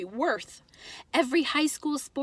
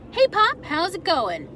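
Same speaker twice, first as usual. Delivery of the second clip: put-on higher, louder breezy boy; casual kid greeting; 6 semitones higher, 5 dB louder than usual. Boy strolling into the kitchen, faking casual. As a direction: act like nothing's up. Narrator voices his casual self.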